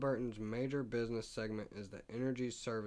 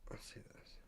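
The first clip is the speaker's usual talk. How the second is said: whisper; 14 dB quieter than usual. Real, not acted. Muttering to self while reading.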